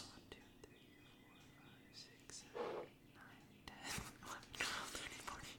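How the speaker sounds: counting in a whisper. Real, not acted. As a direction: whisper the count under your breath. Counting operas under breath.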